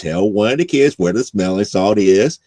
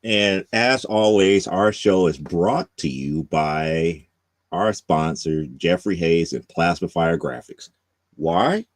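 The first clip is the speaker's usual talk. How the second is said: slow, lower announcer read; sponsor read; 6 semitones lower, 6 dB quieter, slower than usual. Acted. Host closing the show with the sponsor plug, slow announcer read. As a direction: roll out the sponsor credit like an ad.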